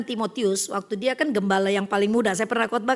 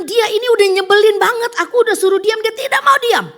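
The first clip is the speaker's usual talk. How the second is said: high, loud frustrated rant; angry outburst; 11 semitones higher, 11 dB louder than usual. Retold or imitated. Young man complaining about the preacher, loud angry rant. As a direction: spit out the complaint in a raised voice. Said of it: "Tapi dia bilang".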